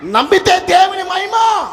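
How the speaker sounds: high, slow, full-force shout; rousing shout. Real, not acted. Preacher rallies the congregation with a soaring, slow shout. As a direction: lift the church with a ringing cry.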